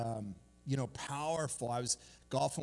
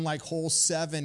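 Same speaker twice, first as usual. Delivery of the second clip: normal talking voice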